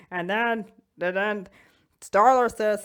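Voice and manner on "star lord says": usual speaking voice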